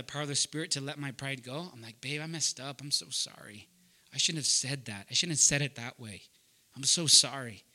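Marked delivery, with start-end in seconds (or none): 2.0-7.6 lower, softer, humble apology; sincere apology. Retold or imitated. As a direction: say sorry gently to your wife. Pastor's apology to his wife.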